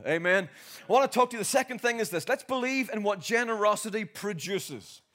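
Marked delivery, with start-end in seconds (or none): none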